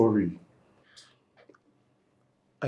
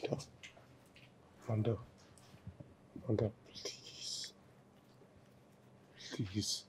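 desperate whisper to his wife; whispered plea; 17 dB quieter than usual. Acted. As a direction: barely breathe the plea. Husband begs in a whisper.

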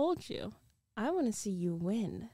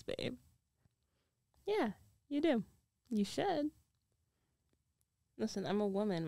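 usual speaking voice

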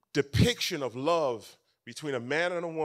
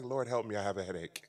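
dropping lower and softer; mock weariness; 7 semitones lower, 3 dB quieter than usual. Dad to his child after the opera, mock weary. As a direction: imitate a worn-out dad, lightly sighed.

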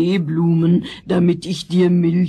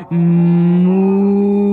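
cow moo; 5 dB louder than usual. Acted. Cow character mooing.

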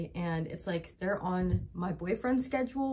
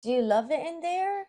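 pet talk; 8 semitones higher, 3 dB louder than usual. Real, not acted. Talking sweetly to the puppy.

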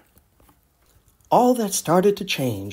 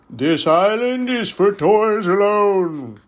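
louder, slow regal king; toy king; 4 semitones higher, 4 dB louder, slower than usual. Acted. King of island decrees.

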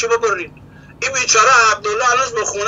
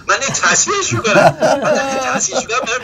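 laughing while quoting; 5 semitones higher than usual. Retold or imitated. Scribe boasting to his wife that he fixes verses, laughing. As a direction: chuckle through the words as the scribe boasting.

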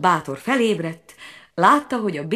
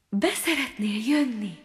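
kind voice; 6 semitones higher than usual. A narrator's reading. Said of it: "csendült meg egy kedves hang".

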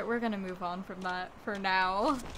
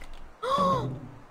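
sharp gasp; 14 semitones higher, 3 dB louder than usual. Real, not acted. Surprise in game.

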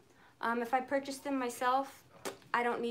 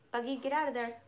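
ordinary talk, nothing marked

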